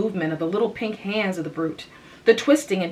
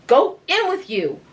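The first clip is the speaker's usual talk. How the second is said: urging; 9 semitones higher than usual. A narrator's reading. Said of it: "urged Anne".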